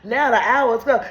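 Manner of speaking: ordinary talk, nothing marked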